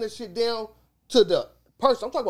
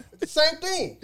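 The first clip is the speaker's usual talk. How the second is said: usual speaking voice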